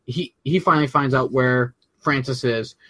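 ordinary talk, nothing marked

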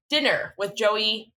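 ordinary delivery

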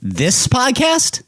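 high, drawn-out question of disbelief; incredulous. Real, not acted. Host told he sounds German, incredulous.

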